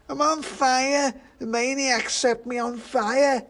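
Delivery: very high, loud, drawn-out wail; burning pudding yelling in outrage; panicked shout. A narrator's reading. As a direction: scream it slowly at the top of the voice. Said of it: "shouted the pudding".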